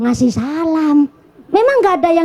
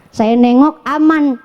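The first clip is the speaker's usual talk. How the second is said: ordinary delivery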